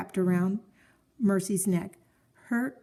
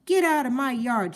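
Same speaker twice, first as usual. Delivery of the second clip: higher, louder order to leave; shouting; 6 semitones higher, 4 dB louder than usual. A narrator's reading. Eugenia through the window shouting at the pig to leave. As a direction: bark it at the pig in the yard. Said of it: "shouted Eugenia".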